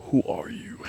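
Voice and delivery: breathy soft whisper; intimate whisper. Pretend. Asking a new friend's core self, whispered. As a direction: lean in and whisper the question. Wanting to know people's core.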